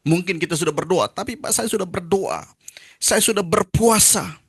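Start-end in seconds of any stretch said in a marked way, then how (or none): 1.1-4.4 whiny congregant. Retold or imitated. Congregant protesting to the pastor.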